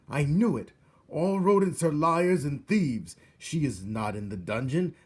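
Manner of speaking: loud, high furious royal rant; a king refusing to believe; angry king. A narrator's reading. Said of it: "said the king".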